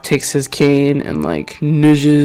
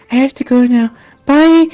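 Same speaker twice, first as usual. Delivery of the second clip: put-on child voice; 10 semitones higher, 5 dB louder than usual. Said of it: "Ring Ring turns to you guys and goes".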